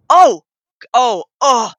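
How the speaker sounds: ordinary talk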